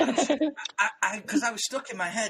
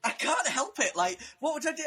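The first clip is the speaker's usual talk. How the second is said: usual speaking voice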